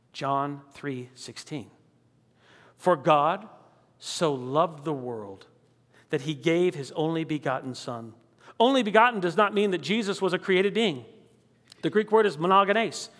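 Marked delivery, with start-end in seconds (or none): 0.1-8.1 hushed reverent reading. Acted. Solemn scripture reading.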